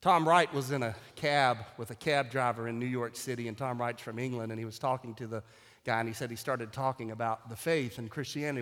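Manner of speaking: normal talking voice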